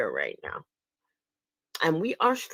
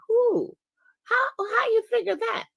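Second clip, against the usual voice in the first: incredulous. Real, not acted. Incredulous at deserter claim.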